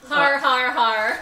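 shouted har, har; loud. Acted. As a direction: bellow the word out loud. Loud mock accent word.

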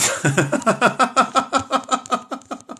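high staccato giggling run; laughing. Real, not acted. Host reading the chat comments, high helpless laugh. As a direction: break into a long, high cackle.

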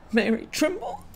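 high, real breaking voice; emotional wreck. Real, not acted. Naming her late mother to viewers, voice breaking with emotion. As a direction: apologize as the tears take over. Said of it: "like an emotional wreck".

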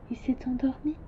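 whispered so the sheep stays asleep; soft whispered aside. Acted. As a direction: hush it, barely any voice. Prince notes sheep asleep.

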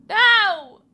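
loud, high wail of dismay; anguished no. Real, not acted. Player watching her progress vanish, loud anguished wail. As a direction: cry out as the puzzle resets. Puzzle reset to beginning.

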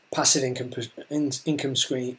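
tripping over the words passive income stream; fumbled, broken start on "in-, income stream"; stumbling. Real, not acted. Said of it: "let me try that again".

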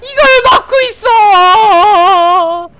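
slow, loud drawn-out cry; long-held shout of disbelief; incredulous yell. Real, not acted. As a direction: stretch the last word into a wail. Disbelief at blocked play.

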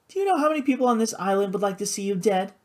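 fast, high feminine voice; urgent warning. A narrator's reading. Sister warning her brother of danger, urgent. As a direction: rush out the warning, pitched high.

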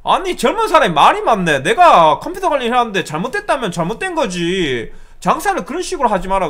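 loud, high-pitched imitated tirade; angry outburst. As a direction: blow up like an irate caller scolding. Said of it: "갑자기 막 버럭하면서 화를 내면서".

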